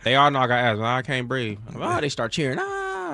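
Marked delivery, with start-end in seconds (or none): 2.6-3.1 high strained gasp; mock wheezing gasp. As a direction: imitate an asthma gasp. Imitating asthma gasp.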